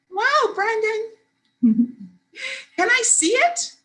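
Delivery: put-on high, eager little-kid wonder; wowed little sister. A narrator's reading. Little sister marvelling at her brother's money, wowed.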